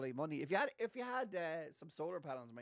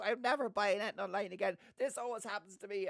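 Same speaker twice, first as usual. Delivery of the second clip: higher, louder impression of a ranting brother; mock a brother's aggrieved outburst; put-on exasperated complaint, pitched up; imitated rant; 6 semitones higher, 4 dB louder than usual. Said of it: "he started ranting at me".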